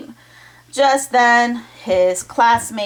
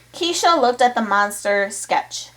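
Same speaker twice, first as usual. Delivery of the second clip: usual speaking voice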